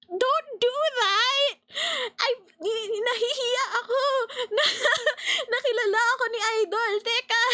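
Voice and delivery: high, crying-laughing squeal; flustered fangirl squeal. Retold or imitated. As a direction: squeal as if overwhelmed by a fan moment. Retold flustered fangirl outburst.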